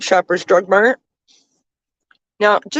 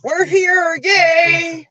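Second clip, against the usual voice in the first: high, cheering shout of arrival; excited arrival cheer; 12 semitones higher, much slower than usual. Real, not acted. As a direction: call out happily that we made it. Arriving at sister's house.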